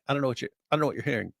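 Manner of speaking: normal talking voice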